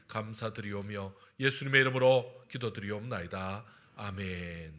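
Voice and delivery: earnest prayer, rising in force then settling on Amen; public prayer. Real, not acted. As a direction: lead the congregation in prayer, pressing each plea. Leads a congregational prayer.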